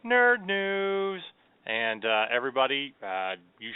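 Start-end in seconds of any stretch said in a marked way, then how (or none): 0.1-1.2 nerd news called out higher and a bit louder, like a jingle; segment announcer call. Acted. Host kicking off the news segment to listeners, announcer call. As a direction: announce the segment title with punch.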